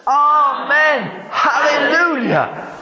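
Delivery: very high shouted praise, drawn out; joyful shout. Shown as a demonstration. Leading the congregation again, a high joyful shout. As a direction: shout it again, even bigger.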